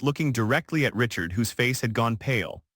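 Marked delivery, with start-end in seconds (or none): none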